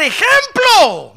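loud, high and drawn out; shouted word. Real, not acted. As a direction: hammer the word home. Preacher's emphatic shout.

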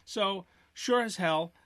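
drawn out and deliberate; slow ominous setup; slow emphatic. Real, not acted. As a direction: stretch it out for drama.

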